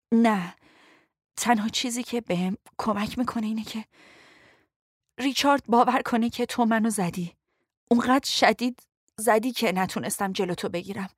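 choked, weakened pained voice; hurting, strained and breathy. A narrator's reading. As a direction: speak haltingly through pain. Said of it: "صدایش گرفته بود".

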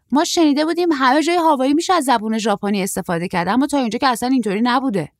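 put-on high, loud, hurried young man's voice; short surfer, puzzled.

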